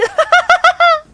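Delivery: high, loud cackle; shrill mocking laugh. Announcer cackling at the eliminated candidate. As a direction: cackle down at the loser.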